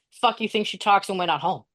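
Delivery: ordinary talk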